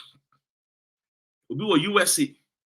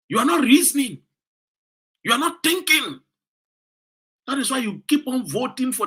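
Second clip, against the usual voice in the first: scolding; 6 semitones higher than usual. Real, not acted. Scolding Ghanaian voters.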